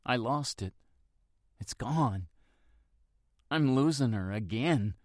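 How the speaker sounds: tearful, fighting sobs; holding back sobs. A narrator's reading. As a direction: voice breaking, holding back tears. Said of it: "hold back the sobs".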